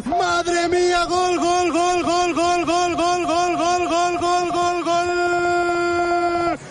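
long chanted goal cry; breakneck goal commentary. Acted. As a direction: rattle off gol after gol, excited. Goal called in radio commentary.

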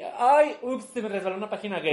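high, loud mock-innocent "oops"; feigned accident. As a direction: play an innocent slip, hammed up with a laugh.

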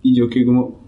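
usual speaking voice

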